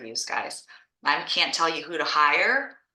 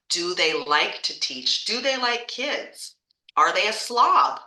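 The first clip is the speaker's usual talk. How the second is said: ordinary talk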